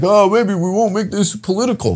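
high, light mock voice; mimicked wishful thinking. Host mimicking hopes of no politics, high mock voice. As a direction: lift it high and small, gently mocking. Mimicking a hopeful thought, pitched up.

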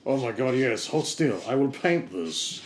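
ordinary talk

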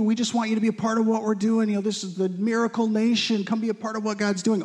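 high, sweet persuasive invitation; coaxing sales pitch. Pretend. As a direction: lure them in like a smooth recruiter. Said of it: "It looks like Israel saying".

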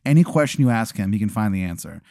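ordinary delivery

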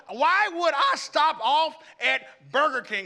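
loud, high, slowed rhetorical question; mock outrage. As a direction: ham up the absurdity.

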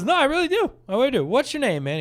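imitated eager caller, high and emphatic; prank caller. Retelling Christoph's insistence to scammer.

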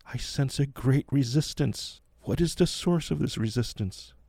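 sense it out like a psychic; Zora the medium. A narrator's reading. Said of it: "Zora chimes in".

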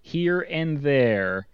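drawn out, mocking the phrase; slow, sarcastic emphasis; slow mocking quote.

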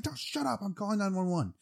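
very high, strained play-acted panic; mock hysterical retort. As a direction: squeal back in imitated desperation. Acting out panicked retort to bodyguard.

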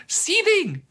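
one word, flung out high. Real, not acted. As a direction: spit out the word like boiling over. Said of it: "I'm legitimately seething".

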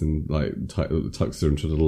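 normal talking voice

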